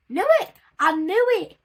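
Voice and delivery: high, loud triumphant shout; excited shout. Real, not acted. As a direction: yell it like you called it. Expected failure confirmed.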